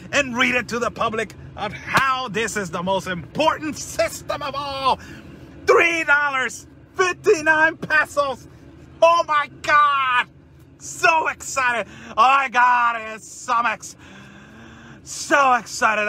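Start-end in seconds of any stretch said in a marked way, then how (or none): 5.6-16.1 mock excitement. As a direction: overdo the delight, mocking the book. Sarcastic cheering over the price.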